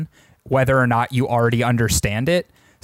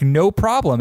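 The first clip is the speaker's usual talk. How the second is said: normal talking voice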